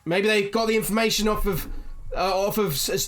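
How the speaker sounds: high, loud, indignant ranting; angry outburst. Acted. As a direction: rail at the leader, distrustful and furious. Angry villager continues accusing.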